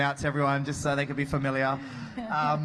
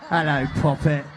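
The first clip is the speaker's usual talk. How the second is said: put-on London street accent; 3 semitones lower, 5 dB louder than usual. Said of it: "I should have done a different accent for Cole".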